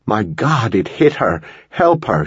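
high-pitched cry of alarm; contained scream, pitch leaping up on the plea; narrated scream. A narrator's reading. Caitlin begging a fireman to help Marcy, contained scream. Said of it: "she screamed at the fireman".

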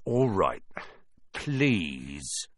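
grudging surrender, sinking low; Filch. Acted. Filch forced to say please, grudging and low. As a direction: give in reluctantly, pitch dropping.

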